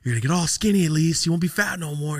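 high-pitched, upbeat pep talk; hyping him up. Retold or imitated. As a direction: cheer your friend on insistently. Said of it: "I'm just trying to, like, hype him".

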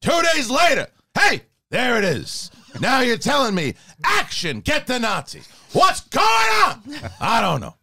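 Al Pacino. Acted. Actor grumbles about the network to listeners, yelling Pacino impression. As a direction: swing from disbelief up to a yell, then drop. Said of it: "Pacino just went from being like that really high voice".

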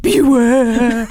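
ghostly "Beware" with weight; spooky warning. Acted. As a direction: intone it like a ghost. Acting the demon's warning.

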